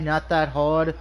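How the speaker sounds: normal talking voice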